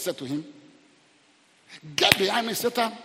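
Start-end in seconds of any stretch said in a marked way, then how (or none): 1.9-2.9 loud, raised-pitch command; forceful rebuke. Retold or imitated. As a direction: order the enemy away with force. Quoting Jesus rebuking Satan.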